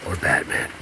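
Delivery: low gravelly whispered Batman. Shown as a demonstration. Showing off voice impressions for a radio gig, Batman rasp. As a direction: growl it like Batman. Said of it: "I can do all kinds of things".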